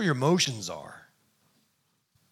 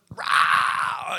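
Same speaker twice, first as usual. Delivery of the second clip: put-on child's roar. Riled-up children imitated.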